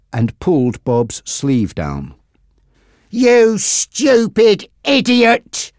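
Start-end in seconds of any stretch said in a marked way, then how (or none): 3.1-5.7 slow, higher, scornful outburst; angry cry. Acted. Frankie at Bob, crying out in scorn. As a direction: spit the insult out. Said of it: "cried Frankie".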